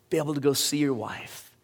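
ordinary talk, nothing marked

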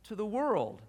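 scripture reading. Acted. Paul said.

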